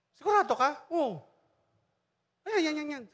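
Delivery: voicing Pastor Alex.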